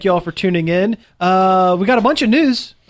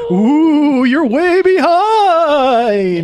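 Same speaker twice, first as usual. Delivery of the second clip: spooky ooh; 10 semitones higher, 5 dB louder, much slower than usual. Pretend. Teasing spooky Christmas warning.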